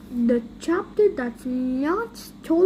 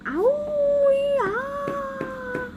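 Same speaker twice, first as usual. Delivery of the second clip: long, high held howl; dog howl; 9 semitones higher than usual. Acted. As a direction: draw out a high doggy wail. Dog howls his answer.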